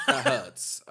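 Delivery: high, loud mock wounded cry; mock hurt. Stung by the teasing, high mock wounded. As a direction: squeal it like you're wounded. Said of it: "you were just doing your regular voice".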